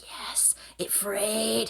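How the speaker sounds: slow breathy whisper of delight; gleeful whisper. Retold or imitated. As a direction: whisper it like a secret win. Gleeful reaction to rope fraying.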